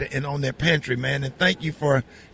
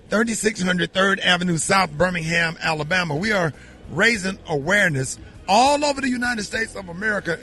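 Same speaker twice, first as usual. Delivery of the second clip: ordinary delivery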